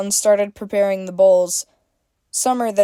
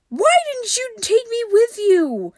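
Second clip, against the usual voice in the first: whiny April. A narrator's reading. April voiced, much higher.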